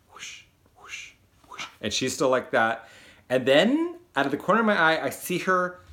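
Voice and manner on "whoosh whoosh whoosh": breathy whooshing noise, very soft; paper whoosh. Retold or imitated. Paper dropping under the desk, whooshing sound effect. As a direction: blow out the sound of falling paper. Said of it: "it goes whoosh".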